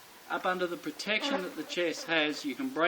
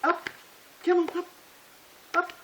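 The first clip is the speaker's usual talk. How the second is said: high, excited call to the dog; coaxing dog call; 13 semitones higher, 3 dB louder than usual. Real, not acted. Trainer calling his dog to jump up on him, high and excited. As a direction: coax the dog up with a high, eager voice.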